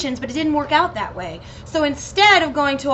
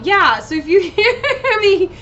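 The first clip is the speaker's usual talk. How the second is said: giggly; 7 semitones higher, 4 dB louder, slower than usual. Real, not acted. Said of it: "getting a little giggly".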